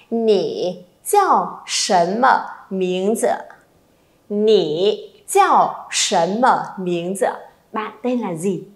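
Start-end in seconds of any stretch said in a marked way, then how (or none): 0.1-3.4 slow, syllable by syllable; slow model reading. Shown as a demonstration. Teacher modelling the question for students, slowly. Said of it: "đây vẫn là cái câu hỏi ban nãy". 4.3-7.3 very slow, drawn out question; slow model reading. Shown as a demonstration. Teacher repeating the question for the class, very slowly. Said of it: "đây vẫn là cái câu hỏi ban nãy".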